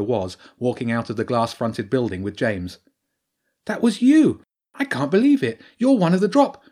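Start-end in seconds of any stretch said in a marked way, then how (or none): 3.6-6.6 a loud high shocked cry; shocked outburst. A narrator's reading. Girl realises her sister is a droplifter, shocked loud cry. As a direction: catch her sister red-handed, burst out loud.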